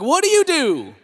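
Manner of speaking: lightly mimicked nurse, high and arching; acted nurse question. Nurses making small talk with his wife, lightly mimicked high voice.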